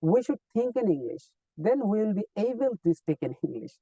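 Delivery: we should think in English said very slowly and clearly. Shown as a demonstration. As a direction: teach the sentence slowly, word by word. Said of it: "think করবো ইংলিশে".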